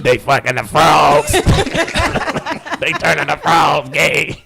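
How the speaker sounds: slow, booming conspiracy shout; mock Alex Jones rant. Podcast host mocking Alex Jones with a slow, shouted rant. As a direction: ham up a raving radio host yelling about frogs.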